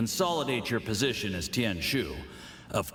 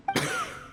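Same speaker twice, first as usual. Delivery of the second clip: cough. Acted. Still coughing from the drink.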